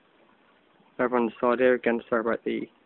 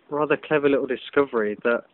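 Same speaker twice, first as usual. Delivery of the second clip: ordinary talk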